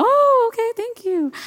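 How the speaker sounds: hammed-up sugary kid voice; mock sweet high reply. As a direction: chirp it up sweetly, faking agreement. Sarcastic child reply to mom.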